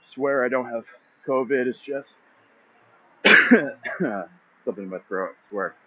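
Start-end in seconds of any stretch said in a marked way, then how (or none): 3.2-4.3 sharp real cough; coughing fit. Real, not acted. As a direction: cough hard, clearing the throat. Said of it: "Sorry, I'm coughing".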